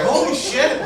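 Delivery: high-pitched mock astonishment; acted amazement. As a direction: react as if floored by news. Acts stunned reaction to confession.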